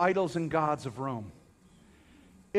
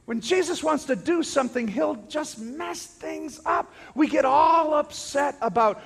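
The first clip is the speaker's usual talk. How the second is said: ordinary talk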